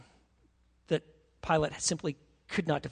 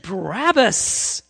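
crowd's drawn-out answer; 8 dB louder, much slower than usual. Retold or imitated.

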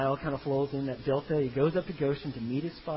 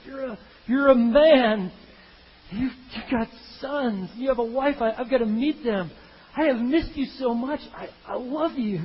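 acted tearful-reunion outpouring, high and loud; overwhelmed joy; 8 semitones higher, 7 dB louder than usual. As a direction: pour it out to a long-lost son. Father overwhelmed at reunion with son.

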